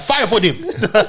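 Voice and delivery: slow, high mock Jamaican accent, laughing; laughing through a Jamaican accent. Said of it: "the illest Jamaican accent".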